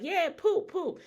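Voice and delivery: put-on high excited little kid; imitating toddler. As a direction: squeal it like a proud toddler. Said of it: "he like".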